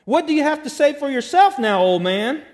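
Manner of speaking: taunting delight. A narrator's reading. Heckler in the crowd to the old pastor, taunting. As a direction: call it out as a gleeful taunt. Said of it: "in taunting delight".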